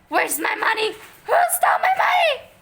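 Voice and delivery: high, loud frantic yelling; outraged shout. A narrator's reading. Boy shouting about his missing money. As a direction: shout it, demanding answers.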